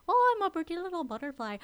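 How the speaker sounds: Heimlich impression. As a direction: gush like an excited bug who just became a butterfly, barely put on. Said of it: "that's really not how he sounds".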